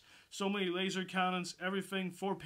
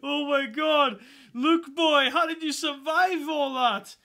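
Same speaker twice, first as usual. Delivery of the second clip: amazed fan outburst; 8 semitones higher, 11 dB louder, slower than usual. Retold or imitated. Awed fan reacting to Luke.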